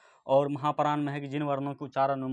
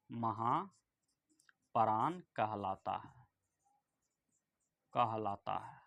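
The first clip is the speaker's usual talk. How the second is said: very slow, lower and softer dictation; drawn-out, quiet word-by-word delivery; 4 semitones lower, 7 dB quieter, much slower than usual. Shown as a demonstration. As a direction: stretch each word out for students writing it down. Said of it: "यहाँ लिख लीजिए".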